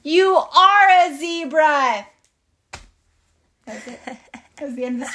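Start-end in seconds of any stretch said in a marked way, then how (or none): 0.0-2.1 loud, high, drawn-out announcement; dramatic reveal. Acted. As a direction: stretch out the punchline triumphantly. Big reveal to Frank.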